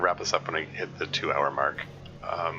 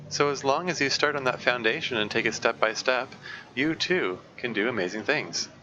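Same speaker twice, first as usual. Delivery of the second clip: brisk read-aloud voice, a bit higher; 4 semitones higher than usual. Acted. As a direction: read the comic's advice out to the chat. Streamer reads comic's advice text aloud.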